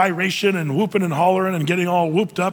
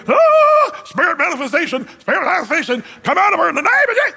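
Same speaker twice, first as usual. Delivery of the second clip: much higher, loud, fast mock holler; whooping hollering exorcist; 10 semitones higher, 5 dB louder than usual. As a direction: ham up a wild charismatic deliverance. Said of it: "He didn't whoop and holler".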